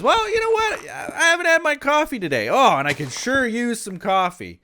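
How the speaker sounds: high, put-on voice for the visiting rep; hammed-up guest asking for coffee; mimicking the guest. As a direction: play up the tired guest pleading for coffee. Guest's request, retold.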